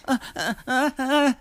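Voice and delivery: high-pitched fake sobbing; squeaky pretend sobs; mock crying. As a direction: imitate little bursts of crying, hammed up. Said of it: "and she's crying".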